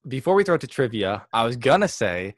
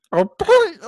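squawky duck voice attempt, much higher and louder; attempted Donald Duck; 7 dB louder than usual. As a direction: try a duck voice and crack up. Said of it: "here we go".

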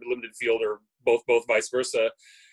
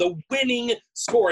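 winning drawn out, high and slow; slow, high-pitched stress on winning; emphatic insistence; 8 semitones higher, 4 dB louder, slower than usual. Real, not acted. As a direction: stretch and lift the word winning to drive it home. Repeats it again over other measures.

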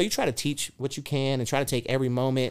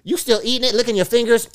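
mock scolding; 10 semitones higher, 10 dB louder, faster than usual. Dad mock-scolding son.

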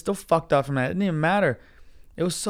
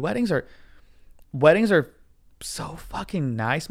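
ordinary delivery

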